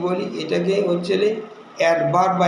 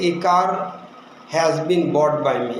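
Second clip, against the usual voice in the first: slow example read; 3 semitones lower, much slower than usual. Shown as a demonstration. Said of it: "তখন হবে যে".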